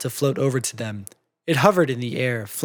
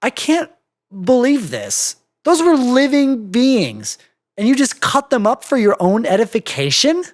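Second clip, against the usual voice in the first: indignant anger; 8 semitones higher, 3 dB louder than usual. Acted. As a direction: accuse her in disbelief. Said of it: "Indignation and a little anger".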